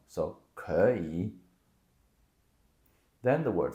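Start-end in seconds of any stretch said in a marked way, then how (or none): 0.5-1.3 可以 with 可 rising in second tone, slow; 可以 said ké yǐ, slow and clear; tone sandhi. Shown as a demonstration. Teacher modeling the correct 可以 slowly. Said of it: "the 可 becomes the second tone".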